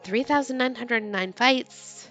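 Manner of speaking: usual speaking voice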